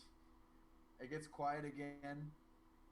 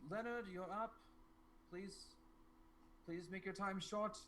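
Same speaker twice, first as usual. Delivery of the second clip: higher-pitched official calling names; town advisor; 5 semitones higher, 3 dB quieter than usual. Acted. Advisor calling the next petitioner, politely pressing. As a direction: hurry the petitioner along in a higher voice. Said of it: "the advisor comes out".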